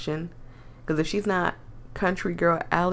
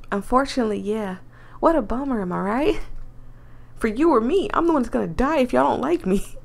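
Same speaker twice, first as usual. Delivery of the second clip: put-on secretive country girl voice; Southern accent; 3 semitones higher than usual. Voices Allie.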